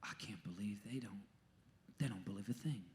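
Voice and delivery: low, near-whispered disbelief; dismayed marveling. Retold or imitated. Jesus at people's lack of faith, hushed dismay. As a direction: say it very softly, as if stunned. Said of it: "Or is he gonna go".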